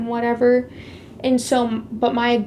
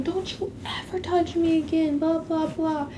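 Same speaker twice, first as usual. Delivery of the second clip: retold angry yelling; 6 semitones higher, 5 dB quieter than usual. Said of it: "I was so mad that I was still yelling".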